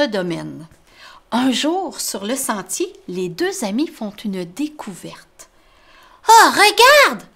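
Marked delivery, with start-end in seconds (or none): 6.3-7.2 high, loud excited mole voice; a little mole who just spotted something; Tom the mole, excited. Acted. Tom to his friend Grumbo, high excited voice. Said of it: "dit Tom".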